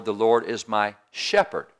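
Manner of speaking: slow down, then land hard on "shepherd"; emphatic line saving the weight for "shepherd"; stress on SHEPHERD. Shown as a demonstration. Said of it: "take one word and just emphasize it".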